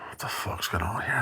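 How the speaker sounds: unvoiced panicked whisper; whispered thought. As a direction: hiss it under your breath in shock. Retold inner thought, whispered.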